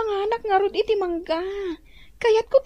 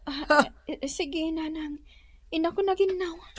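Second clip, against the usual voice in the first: much softer, hesitant; meek reply; 3 semitones lower, 7 dB quieter than usual. Acted. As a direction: answer the scolding meekly. Meek reply to scolding mother.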